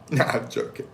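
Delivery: ordinary talk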